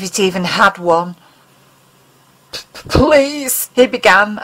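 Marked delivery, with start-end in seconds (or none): 2.5-3.7 slow, higher, stammering plea; tearful stammer. Acted. Mulder begins begging the alien, stammering in tears. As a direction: choke the please out through tears. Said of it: "allowing the tears to roll down".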